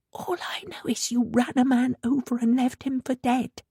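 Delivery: voiceless whisper. A narrator's reading. Anna whispering accusation to mother. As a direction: whisper it close and hard.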